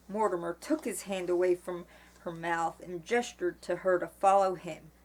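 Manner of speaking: usual speaking voice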